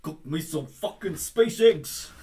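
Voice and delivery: put-on slow, loud over-the-top Kiwi accent; New Zealand accent. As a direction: lay the Kiwi accent on thick. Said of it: "an overly New Zealand accent".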